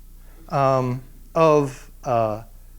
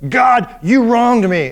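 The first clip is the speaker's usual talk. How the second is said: accusing cry; 5 semitones higher, 6 dB louder, slower than usual. Retold or imitated. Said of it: "Job has said".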